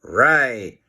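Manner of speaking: ordinary delivery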